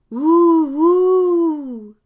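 loud high hooting; ghostly moan. Acted. Ghost hooting again at Dominique, loud moan. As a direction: wail it like a phantom.

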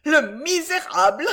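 high, loud outraged cry; indignant. A narrator's reading. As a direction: exclaim with energetic indignation. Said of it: "s'écria la demoiselle avec une énergique indignation". (cri indigné et aigu)

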